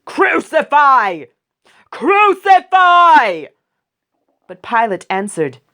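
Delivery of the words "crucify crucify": slow, very high, loud scream of crucify; furious crowd shout. Acted. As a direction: roar it like a baying mob. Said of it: "they shouted".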